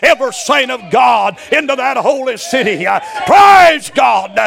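loud, slow, ringing preacher cadence; full-force preaching. Real, not acted. Pastor preaching hard to the church, loud and ringing. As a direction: preach it out to the congregation. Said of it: "I'm preaching too hard".

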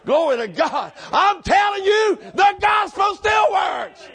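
high, loud, drawn-out shout; full-force preaching. Acted. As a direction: holler it out in triumph.

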